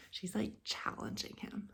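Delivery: soft unvoiced aside; whisper aside. Real, not acted.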